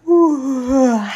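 big, loud sleepy yawn. A narrator's reading. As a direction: stretch it out like a mole just waking. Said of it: "gähnte der Maulwurf". (lautes, verschlafenes Gähnen)

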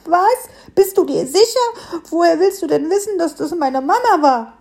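high, probing piglet voice; suspicious questioning. A narrator's reading. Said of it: "forschte der Frischling misstrauisch nach".

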